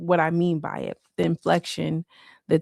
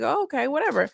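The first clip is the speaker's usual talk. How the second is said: high dismissive okay; 8 semitones higher, faster than usual. Retold or imitated. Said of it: "I'm like".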